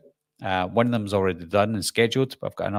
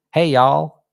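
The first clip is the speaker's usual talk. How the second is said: higher, louder hammed-up Southern drawl; mock Southern US accent; 5 semitones higher, 8 dB louder than usual. Scottish host jokes about sounding Texan, mock Southern accent. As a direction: put on a joking Texas twang. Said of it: "That's not even Texas".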